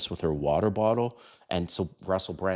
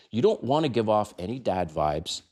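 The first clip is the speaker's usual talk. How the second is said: ordinary talk, nothing marked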